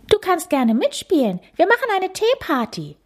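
put-on high sweet girl voice; inviting. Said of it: "sagt Pippa einladend".

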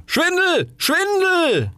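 very high shouted outrage; loud cry. Acted. Roman candle and Bengal fire shout down the rocket, loud and outraged. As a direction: yell it out indignantly. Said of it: "riefen ganz laut".

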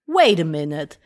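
low, drawn-out wait a minute; dramatic realization. Retold or imitated. She spots the Gravis store, low mock-dramatic. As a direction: sound like a movie hero catching on. Sudden idea in English aside.

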